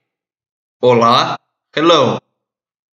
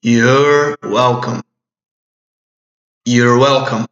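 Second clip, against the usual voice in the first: drawn-out, very slow "You're welcome"; slow model; slower than usual. Shown as a demonstration. Teacher modeling the phrase slowly for students. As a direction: stretch every syllable for learners. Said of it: "teacher models the phrase".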